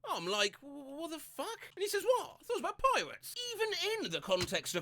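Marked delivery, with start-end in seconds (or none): 0.6-1.7 soft, high, drawn-out disbelief; bewildered. Retold or imitated. Reacting to friend's singing, bewildered. 2.0-3.4 high-pitched, innocent protest; defensive surprise. Retold or imitated. Friend defending his misheard lyric, high defensive surprise.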